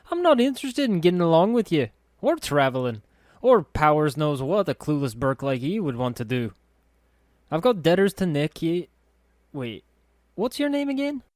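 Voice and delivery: high, loud and fast brush-off; scornful girl voice. A narrator's reading. Anna brushing off the narrator, high and scornful. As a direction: cut him dead like a scornful lass.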